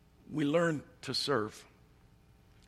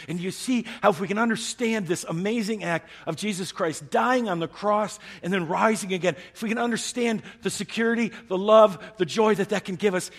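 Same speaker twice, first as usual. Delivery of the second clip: high, loud, building sermon climax; full-force preaching; 10 semitones higher, 8 dB louder than usual. Acted. Preacher rousing the congregation to freedom, high and loud. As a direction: lift them up with rising fervor.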